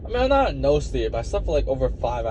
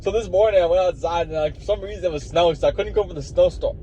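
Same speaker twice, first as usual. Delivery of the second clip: higher-pitched, upbeat video voice; cheerful and a little hyper; happier voice; 6 semitones higher than usual. Shown as a demonstration. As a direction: demonstrate a hyped-up, happy storytelling delivery. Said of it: "I was speaking my more happier voice".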